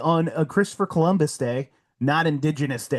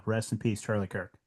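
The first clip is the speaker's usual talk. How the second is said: sombre farewell; 7 semitones lower, 9 dB quieter than usual. Real, not acted. Closing words of mourning.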